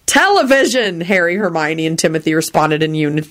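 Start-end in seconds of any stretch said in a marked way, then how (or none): none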